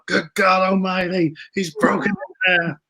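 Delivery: high, slow, booming ringside announcer cry; wrestling commentator impression. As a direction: call it like a stunned commentator at the announce table. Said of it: "And we were like".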